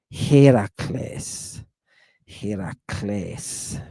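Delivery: Heracles drawn out slowly as "HAY-ra-KLACE"; Greek accent placement. Shown as a demonstration. Teacher demonstrating where the accent sits, Heracles slow as "hay-ra-KLACE". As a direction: stretch Heracles, landing long on the last syllable. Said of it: "Did you hear that accent?".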